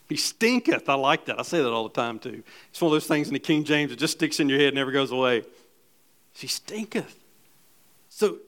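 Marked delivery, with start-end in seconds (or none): 0.1-0.9 higher, amused relish on stinketh; playful King James quote. Acted. 6.3-7.2 hushed, whispered stinketh with a rising end; softly whispered King James line. Acted. Says it again, whispered.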